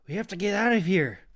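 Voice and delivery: loud, high urgent plea. A narrator's reading. Clove urging Kita to escape. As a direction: push her to flee now.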